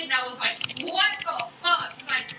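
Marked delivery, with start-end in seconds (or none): 0.8-2.0 high, drawn-out incredulous outburst; retold bafflement. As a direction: act out slow, high-pitched disbelief. Retold baffled reaction to accusation.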